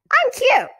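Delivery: very high, loud puppet squeak; squeaky dummy. A narrator's reading. Dummy chimes in about himself, very high squeak. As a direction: pipe up like a ventriloquist's dummy. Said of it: "she made him say".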